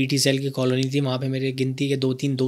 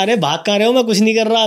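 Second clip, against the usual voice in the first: higher, louder call after running friends; calling after them; 7 semitones higher, 5 dB louder than usual. Retold or imitated. Boy calling after his fleeing friends, raised call.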